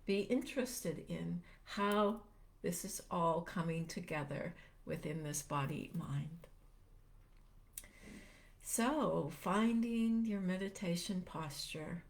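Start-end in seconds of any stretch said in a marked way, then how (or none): 2.8-12.0 unhurried meditation guide, long pauses between phrases; calm, spacious guiding voice; guided meditation. Real, not acted. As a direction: gently lead listeners into stillness, leaving space after each phrase.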